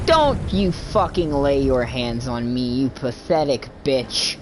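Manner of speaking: low, slow growled threat; low menacing snarl. Acted. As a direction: growl it out slowly. Voiced NPC snarling back.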